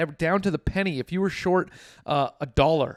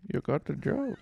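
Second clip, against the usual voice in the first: put-on soft, reluctant Indian accent; underplay an Indian boss's accent, quietly; Indian accent impression; 5 dB quieter than usual. Indian boss hiring a new gas pump worker, soft Indian accent. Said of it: "how did he s- sound, [name], when he hired you?".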